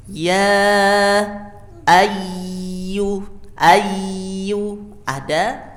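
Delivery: Ya ayu drawn out long; slow drawn-out demo. Shown as a demonstration. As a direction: stretch ayu slowly.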